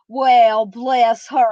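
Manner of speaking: drawled Southern "bless her heart"; Southern accent. Shown as a demonstration. Host asked for their Southern, drawled accent. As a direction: stretch the vowels in Southern style. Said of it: "What about your Southern?".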